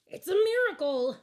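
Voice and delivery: high, loud joyful cry; excited cry. A narrator's reading. Lump of coal finding a barbecue restaurant, crying out in joy. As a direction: shout it out in pure delight. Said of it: "cried the lump of coal".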